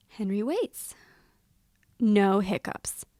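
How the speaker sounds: ordinary delivery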